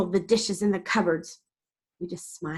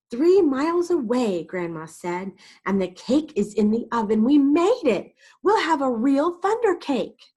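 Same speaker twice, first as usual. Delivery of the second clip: excited grandma; 6 semitones higher, 5 dB louder than usual. Acted. Grandma excited, cake in oven.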